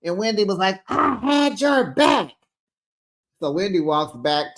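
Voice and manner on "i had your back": much higher, louder and slow; mock shout at a leaving friend; imitated shout. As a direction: wail it out, hurt and angry. Wendy shouting after Stacey.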